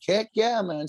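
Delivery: high, eager retold yes; gleeful high-voiced agreement; excited acceptance. Acting out own keen acceptance.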